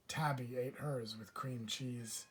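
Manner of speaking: plain reading voice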